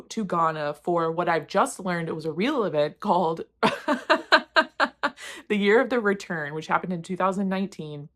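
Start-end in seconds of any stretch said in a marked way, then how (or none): 3.5-5.4 loud, high burst of laughter; helpless high giggling; cracking up. Real, not acted. Cracking up at learning the event was real, loud high laughter.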